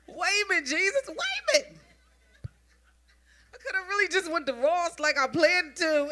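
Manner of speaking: high, loud, laughing disbelief at God; half laughing, half crying protest; a comic wail of why me; exasperated disbelief. Retold or imitated. Retold exasperation at the car boot.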